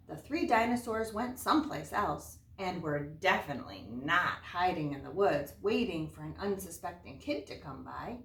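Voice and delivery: story narration. Acted. Teacher reading a fairy tale to her class, lively storyteller voice. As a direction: read it out big for the class. Teacher reads picture book aloud.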